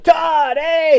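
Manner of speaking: loud, high delighted shout of my name; excited greeting. Retold or imitated. Friend spotting me from a picnic table, loud high greeting. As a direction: call out happily across the picnic area. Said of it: "He's like".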